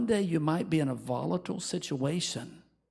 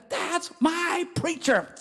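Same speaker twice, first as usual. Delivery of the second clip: high, slow, doting mock voice; a churchgoer gushing over a violent preacher; mock proud fan; 12 semitones higher, slower than usual. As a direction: ham up a proud fan's gushing.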